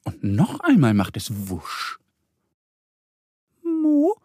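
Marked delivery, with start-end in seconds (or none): 3.6-4.2 tiny, very high duck voice; whispery question. A narrator's reading. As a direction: ask timidly, pitched way up. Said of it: "wispert Annie". (zaghaftes, hohes Entenstimmchen)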